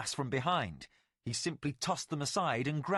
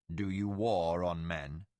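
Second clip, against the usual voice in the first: ancient Valheru lord; 7 semitones lower than usual. Acted. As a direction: speak as a legend come to life. Tomas questions Chuan.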